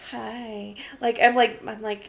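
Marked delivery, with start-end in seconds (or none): 0.1-0.7 flirty hi. Retold or imitated. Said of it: "being like".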